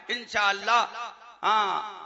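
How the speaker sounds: usual speaking voice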